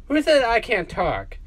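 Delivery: plain reading voice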